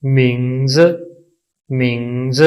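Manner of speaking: मिंग्स drawn out, first part lifted, last part dropped; tone demo. Shown as a demonstration. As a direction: model मिंग्स slowly for learners. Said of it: "इनको बोलने का तरीका".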